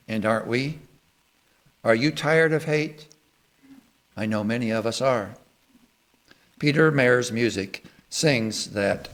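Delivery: ordinary talk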